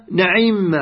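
نعم as na'imma, open a on the nun; fatha nun, kasra ayn. Shown as a demonstration. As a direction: say na-'imma for نعم, i on the ayn and doubled m. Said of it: "هكذا يقرأوها".

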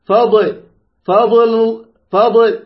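"فضل" with the ض bounced before the ل; repeated wrong demo, echoing ض in "فضل"; faulty qalqalah. Shown as a demonstration. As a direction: pop a tiny vowel after the ض in each "فضل". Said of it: "خطأ".

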